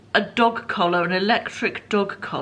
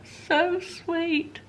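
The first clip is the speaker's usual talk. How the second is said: cooing; 11 semitones higher than usual. Real, not acted. Vlogger coos while stroking the cat. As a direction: coo at a purring toy.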